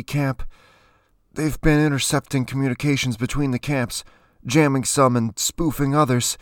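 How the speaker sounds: high, urgent, strained account; desperate high-pitched explanation; strained pleading. A narrator's reading. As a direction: plead your case while pinned by the throat. Pinned man pleading his case.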